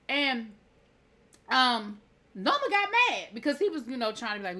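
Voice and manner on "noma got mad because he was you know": ordinary delivery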